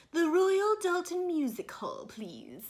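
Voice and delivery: loud, high mock posh English; snooty posh British accent. Reviewer mocking Mary Poppins' overly posh dialect, snooty posh accent. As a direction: exaggerate a snooty upper-class British accent. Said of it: "make fun of really posh accents".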